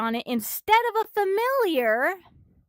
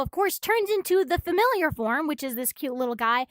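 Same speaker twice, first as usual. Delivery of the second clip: usual speaking voice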